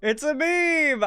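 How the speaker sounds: high-pitched, drawn-out mimicking yell; mock yell. Streamer mimicking a suspect's excuse to police, mock yell. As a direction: yell it like an absurd protest, stretched out. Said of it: "funniest thing you can ever yell".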